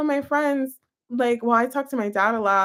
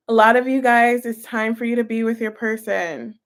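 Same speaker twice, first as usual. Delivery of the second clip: ordinary delivery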